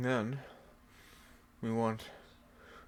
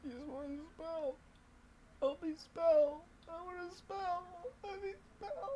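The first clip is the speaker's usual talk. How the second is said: fake whiny crying; 8 semitones higher, 8 dB quieter than usual.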